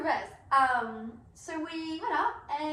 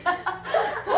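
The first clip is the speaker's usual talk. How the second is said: loud high laugh; high burst of laughter; 8 semitones higher, 6 dB louder than usual. Real, not acted. Friends cracking up at the croc joke, loud high laughter.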